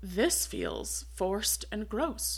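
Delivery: soft, slow, disgusted aside; a soft, slow grossed-out mutter. Retold or imitated. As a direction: mutter it under your breath, slowly. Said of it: "And I'm like".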